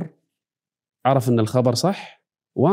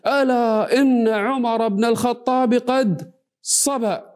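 high, slow announcement to the crowd; proclaiming; 7 semitones higher, slower than usual. Retold or imitated. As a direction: draw out the news like a crier. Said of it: "فأخذ يصرخ جميل".

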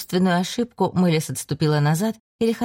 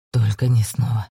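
trembling; 7 semitones lower than usual. A narrator's reading. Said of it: "дрожаще произнёс он".

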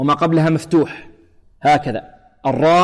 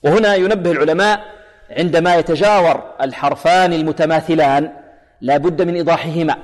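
normal talking voice

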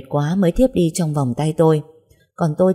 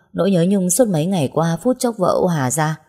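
plain reading voice

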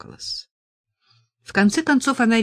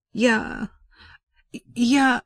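stammering; 6 semitones higher than usual. A narrator's reading. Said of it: "заикалась от волнения".

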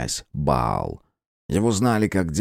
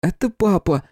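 much higher, louder surprised cry; 13 semitones higher, 4 dB louder than usual. Acted. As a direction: gasp it out in amazement. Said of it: "удивился Любомир".

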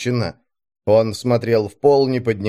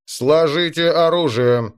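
loudspeaker order; 4 semitones higher than usual. A narrator's reading. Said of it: "через громкоговоритель ... чёткий и безразличный".

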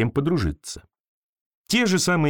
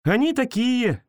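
put-on high girl's voice, slow; girl agrees with creature; girl agreeing; 10 semitones higher, slower than usual. A narrator's reading. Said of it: "согласилась девочка".

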